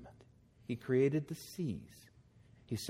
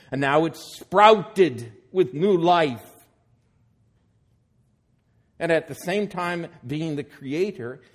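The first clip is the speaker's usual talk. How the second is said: louder, rising proclamation; full-force preaching; 9 dB louder than usual. Acted. Preacher to congregation proclaiming resurrection, full force. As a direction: proclaim the new life with swelling force.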